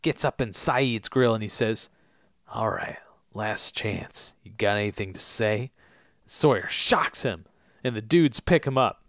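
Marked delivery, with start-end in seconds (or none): 2.5-5.7 low menacing quote. Retold or imitated. Recapper quoting Sawyer at the prisoner, low and menacing. Sawyer's threat in story retelling.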